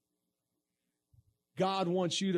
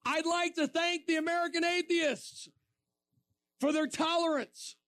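sweetly ironic thank-you, pitched high; mock gracious thanks; 10 semitones higher than usual. Preacher thanking the atheists from their monument, ironic. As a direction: thank them with a wink. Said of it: "I started by saying".

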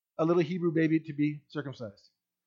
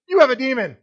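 mock accusation; 6 semitones higher, 10 dB louder than usual. Crowd's retort mocked.

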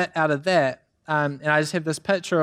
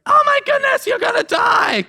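very high, loud squawking mother sparrow; panicked mother bird; 4 dB louder than usual. Retold or imitated. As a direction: shriek it like a frantic bird mother.

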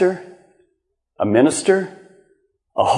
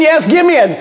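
mock eager idiot; 9 semitones higher than usual. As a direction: ham up a gullible yes. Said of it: "keep answering and saying".

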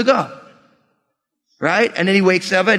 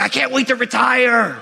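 lightly acted eagerness, higher pitch; mock eager; 5 semitones higher than usual. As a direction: play an impatient worker longing to stop. Eager worker voiced in a sermon.

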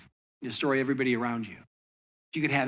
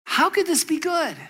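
much higher, loud disbelief; baffled king; 13 semitones higher, 5 dB louder than usual. Retold or imitated. King to the servant who calls prison good, high incredulous. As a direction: throw the question up high in amazement.

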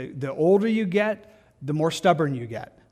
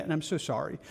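much softer, sincere apology; hushed, apologetic aside; 8 dB quieter, faster than usual. Real, not acted. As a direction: drop the volume right down to apologize. Apologizing for upsetting listeners.

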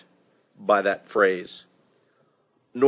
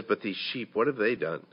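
much softer, pleading repeat; soft plea; 10 dB quieter than usual. Retold or imitated. David pleading softly with the Lord for his people. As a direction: drop to a hushed plea for the sheep. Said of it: "he says".

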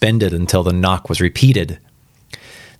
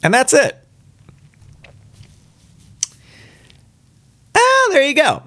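cheery wrap-up. Real, not acted. Finishing the story, amused.

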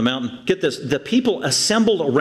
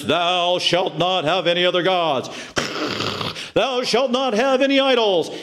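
solemn, measured decree rising in pitch; booming-style mock commandment; God's commandments; 2 semitones higher than usual. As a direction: pronounce it like divine law. Voicing God rehearsing the Ten Commandments.

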